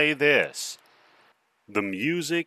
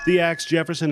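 show host intro; 3 semitones higher, 3 dB louder, faster than usual. Acted. Podcast host opens the show.

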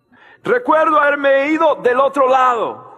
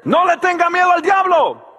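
booming call against fear; shouted warning; 6 semitones higher than usual. Acted. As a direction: shout it out to the whole church. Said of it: "repeats line, second time quietly".

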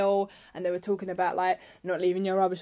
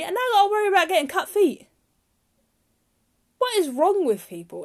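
angry rant; 8 semitones higher, 5 dB louder than usual. Real, not acted. Ranting about glass on beach.

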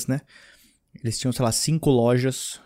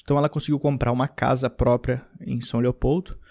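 normal talking voice

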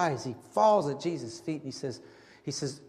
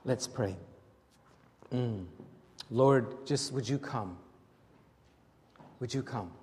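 hushed prayer; 6 semitones lower, 5 dB quieter than usual. Real, not acted. Opening prayer time.

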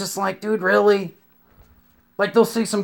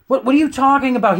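higher, fast disbelief; incredulous; 8 semitones higher, 3 dB louder, faster than usual. Retold or imitated. Arguing with the cop, fast high disbelief. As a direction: act baffled by the accusation.

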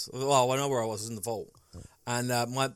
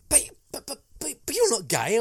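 much higher, stammering imitation of the dad; flustered dad; 10 semitones higher, 5 dB louder than usual. Dad confronting his son after the kiss, high flustered stammer. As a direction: stutter it out like a baffled old-school dad. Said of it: "He go, and he just went".

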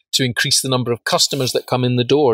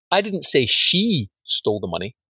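"she" lengthened and lifted, pointing at her; stress on SHE. Shown as a demonstration. Trainer showing how inference shifts, stress on "she". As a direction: put all the weight on "she". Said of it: "you emphasize one different word".

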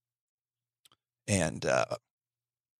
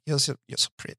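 cooing at dolls; 5 semitones higher, faster than usual. Retold or imitated. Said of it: "Adolf Hitler if he was into troll dolls".

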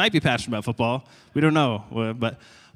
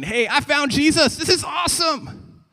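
high, loud elated cry; so excited; 7 dB louder than usual. Acted. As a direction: burst out like a thrilled new convert. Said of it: "we're so excited".

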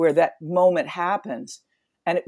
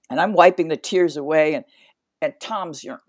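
ordinary delivery

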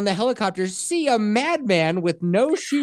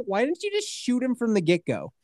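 usual speaking voice

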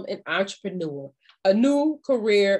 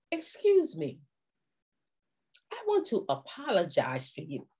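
polite apology; 3 semitones lower, 8 dB quieter than usual. Shown as a demonstration. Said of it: "it goes a little something like this".

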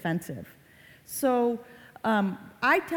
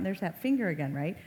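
ordinary talk

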